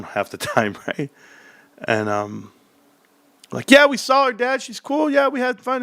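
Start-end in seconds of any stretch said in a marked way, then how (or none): 3.7-5.8 put-on high, innocent kid voice. Kids reassuring dad about mom, high innocent voice. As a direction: sound sweet and innocent to dad.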